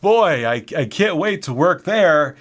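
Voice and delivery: high, loud sarcastic eagerness; mock enthusiasm. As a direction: gush about it as if thrilled. Sarcastic eagerness about the job.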